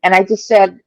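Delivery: usual speaking voice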